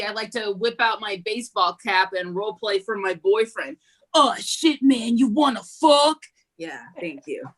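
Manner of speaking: usual speaking voice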